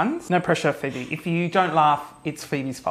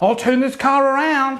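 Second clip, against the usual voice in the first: grandma threatening; 6 semitones higher, 3 dB louder than usual. Retold or imitated.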